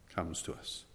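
whispered, breathy and soft; hushed whisper. Real, not acted. Preacher hushing the congregation, whispered. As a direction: drop to a bare whisper.

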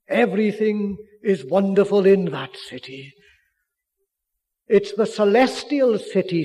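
loud, ringing pulpit proclamation; preaching at full force. Acted. Preacher extolling the celestial city, loud and ringing. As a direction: lift the hall with it, full voice.

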